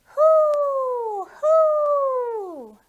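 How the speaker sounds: high, loud owl hoot. Acted. Imitating the owl in rhyme.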